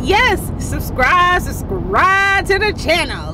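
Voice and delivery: bright high sign-off pitch; cheerful high plea to subscribe. Real, not acted. Upbeat call to subscribe.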